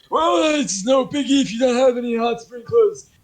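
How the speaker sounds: put-on breezy, loud and high-pitched old oddball; John the oddball. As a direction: play him high and shamelessly loud. Game master plays John.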